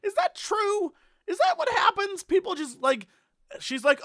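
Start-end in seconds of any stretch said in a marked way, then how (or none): none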